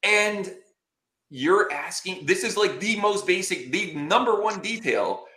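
retold indignant disbelief. Client to his unprepared lawyer on trial day, mounting indignation. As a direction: scold in mounting disbelief, dragging out key words.

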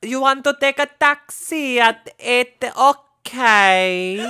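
high, loud Greek lady voice; Greek lady accent. Retold or imitated. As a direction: sing it out like a Greek hostess. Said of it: "Remember the lady from Sifnos".

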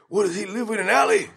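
high, quick incredulous outburst; Harrigan. Acted. Host imitating baffled cop in the alley, high impression. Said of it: "and Harry goes".

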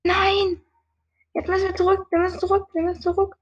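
high, urgent panicked retreat; being chased. Real, not acted. Fleeing back in game.

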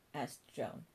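usual speaking voice

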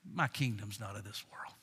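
very low, hushed calm; soft serenity after the storm; peaceful declaration. Acted. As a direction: drop to a quiet, unshaken murmur. Models peaceful answer amid everyone's anger.

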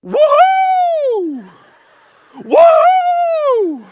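very high, loud laughing whoop; awkward boy forcing a loud happy whoop. Retold or imitated. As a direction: let out a giddy cheer. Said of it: "And I go".

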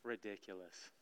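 ordinary talk

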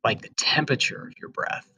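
slow meditation voice with long pauses; guided meditation. Acted. Host leading students through breathing, in a slow guided-meditation voice. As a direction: ease each instruction out slowly, leaving room to breathe.

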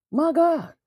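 can't believe what he's hearing; stunned rhetorical question; incredulous. Real, not acted. Disbelief at the lyric.

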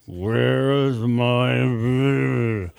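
slow, dragged-out creature mumble; mumbled. A narrator's reading. Burnt creature mumbling at the doctor's ear, very slow. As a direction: stretch each word like a dying thing. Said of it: "it mumbled again".

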